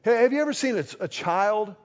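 normal talking voice

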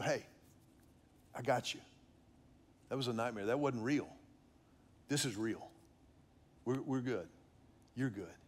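gently rock her back to calm with words; soothing comfort. Retold or imitated. Father holding crying daughter.

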